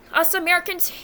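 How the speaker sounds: ordinary talk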